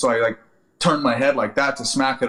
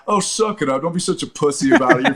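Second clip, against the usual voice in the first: mocking buddies; 4 semitones higher than usual. Buddies mocking him, laughing.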